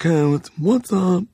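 slow, high put-on robot voice. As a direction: drawl it like a campy robot. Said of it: "It was a voice I used to do".

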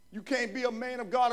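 usual speaking voice

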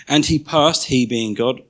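measured, solemn Bible reading; scripture reading. Acted. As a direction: read the verse to the congregation with weight. Reads Exodus passage aloud.

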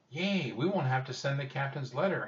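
put-on high, swooping girl's cheer; excited exclaiming. A narrator's reading. Annie cheers about the captain's letter, excited exclaiming. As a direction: exclaim it with childlike glee. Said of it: "Annie exclaimed".

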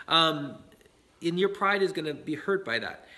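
ordinary delivery

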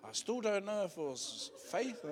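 high voice wobbling with laughter; laughing. Real, not acted. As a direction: joke about your own doubt, giggling. Doubts own choice, laughing.

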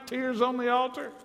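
ordinary talk, nothing marked

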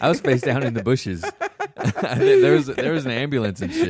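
laughing as he tells it; high giggling breaks through the words. Real, not acted. Laughing while telling how he woke in the bushes.

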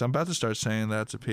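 ordinary delivery

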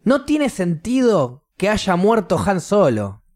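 high, drawn-out mock yell of outrage; retold indignant outcry; imitated kids' shouting. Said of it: "los nenes empiezan a gritar".

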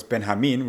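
Benjamin as "ben-ha-MEEN"; Spanish pronunciation. Shown as a demonstration. Coach introducing himself in Spanish, Benjamin pronounced ben-ha-MEEN. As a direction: say Benjamin with a Spanish h-like j and stressed last syllable. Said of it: "Spanish pronunciation of my name".

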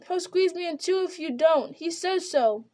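high, crying plea; panicked pleading. A narrator's reading. Merry, crushed in the willow, begs them in terror. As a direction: beg through fear, near tears.

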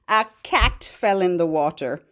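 ordinary talk, nothing marked

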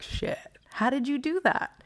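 stunned, breathy whisper of disbelief; whispered shock, pitch jumping up; amazed whisper. Retold or imitated. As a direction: gasp it out in awe. Amazed reaction to Neo.

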